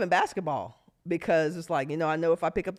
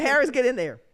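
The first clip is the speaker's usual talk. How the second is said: loud call to send a player in; coach's shout; 7 semitones higher, 6 dB louder than usual. Retold or imitated. Coach ordering Harris into the game. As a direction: call out from the bench. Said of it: "He said".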